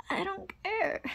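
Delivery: high, laughing dismissal; laughing taunt. Acted. Taunting the shy one who is looking around, laughing.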